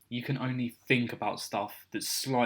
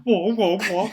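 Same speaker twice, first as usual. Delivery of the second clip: loud garbled wah wah; imitating a drowning mic; 9 semitones higher, 9 dB louder than usual. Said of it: "You sound like you're drowning".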